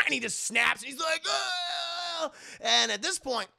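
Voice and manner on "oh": high, drawn-out howl of pain; pained scream. Retold or imitated. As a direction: howl as your arm breaks. Arm broken.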